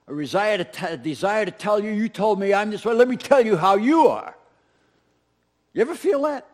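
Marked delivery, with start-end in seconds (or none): 1.9-4.3 louder, higher mock comeback; mock retort. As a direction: hit back at the accuser, half-acted. Voicing urge to retaliate.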